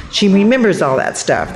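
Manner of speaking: ordinary delivery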